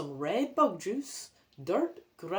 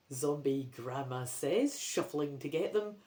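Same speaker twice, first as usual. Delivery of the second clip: plain reading voice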